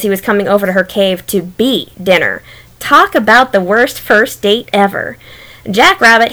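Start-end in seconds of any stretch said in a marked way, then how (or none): none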